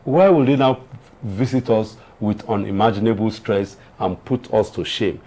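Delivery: usual speaking voice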